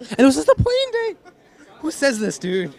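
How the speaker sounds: very high-pitched disbelief; exasperated, voice leaping way up; incredulous. Real, not acted. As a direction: exclaim in laughing, squeaky disbelief. Incredulous about teammate's birthday excuse.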